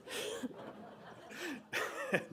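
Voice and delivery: high, soft voiceless laughter; amused laugh. Real, not acted. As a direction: break into a soft real laugh. Storyteller laughs after teenage bit.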